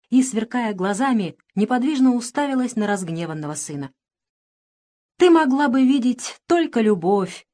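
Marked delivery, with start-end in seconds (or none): 5.2-7.5 raised, heated voice; unrelenting reproach. A narrator's reading. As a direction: keep pressing the accusation. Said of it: "не унимался Иван Сергеевич".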